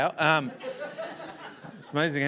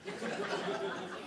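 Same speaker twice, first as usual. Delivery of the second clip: laugh; 11 dB quieter than usual. Real, not acted. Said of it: "That's what I said".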